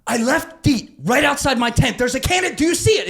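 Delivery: high, loud frantic shout. Retold or imitated. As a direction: rattle off orders in a panic. Frantic instructions from inside the tent.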